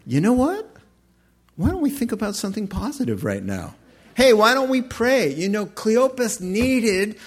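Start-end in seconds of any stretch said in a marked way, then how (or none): 0.1-5.3 lightly voiced wife, higher and perky. Wife breaking off a bad conversation, higher mock-bright voice. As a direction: cut in higher, as a hint of the wife. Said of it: "she'll say".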